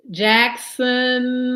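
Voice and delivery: ordinary talk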